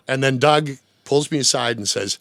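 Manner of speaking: usual speaking voice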